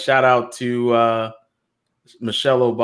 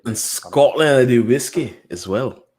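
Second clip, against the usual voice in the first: mock Scottish accent; slower than usual. Still playing with Scottish accent.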